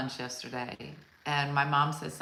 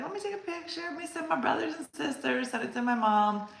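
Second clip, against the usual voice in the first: higher, eager gushing quote; mock eager gushing; 10 semitones higher, 3 dB quieter than usual. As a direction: gush like you can't wait to share it. Mock excited picture-taker quote.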